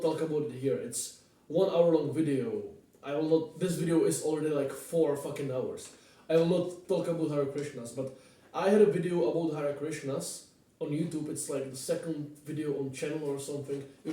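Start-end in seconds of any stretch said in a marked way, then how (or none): none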